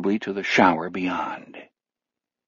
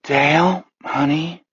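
sleepy voice; 6 semitones higher, slower than usual. A narrator's reading. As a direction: call down half asleep. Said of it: "Sarah's sleepy voice".

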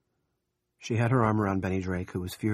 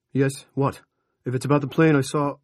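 loud, higher, brusque phone answer; impatient; 5 semitones higher, 8 dB louder than usual. A narrator's reading. Rusty taking a call from Thurston Marshall, impatient. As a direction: cut in on the caller, raised voice.

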